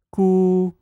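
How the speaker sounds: gu high and level; first tone. Shown as a demonstration. Teacher sings gu for students to repeat, first tone. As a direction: hold gu on one high, even pitch. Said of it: "sing the four tones of this combination".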